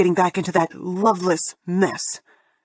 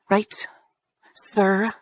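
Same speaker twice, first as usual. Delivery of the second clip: spat sarcasm. Acted. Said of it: "I make sure to spit his favorite word".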